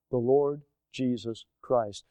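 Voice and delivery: normal talking voice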